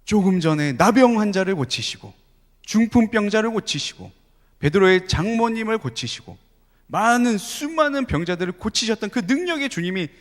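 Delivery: preaching crescendo; rising fervor. Real, not acted. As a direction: build the list with mounting fervor. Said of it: "building list of miracles".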